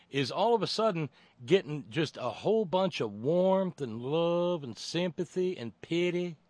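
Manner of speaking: high, loud Southern lilt; put-on Southern sympathy; mock Southern accent. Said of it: "doing this in a Southern accent".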